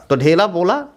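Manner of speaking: ordinary delivery, nothing marked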